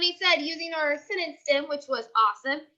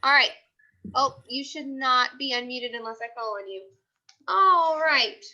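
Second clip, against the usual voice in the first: ordinary talk, nothing marked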